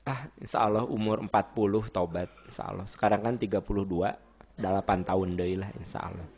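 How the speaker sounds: low, soft musing to oneself; casual procrastinator. Acted. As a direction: put it off quietly, in no hurry. Acts someone casually postponing repentance. (santai menunda, suara rendah dan pelan)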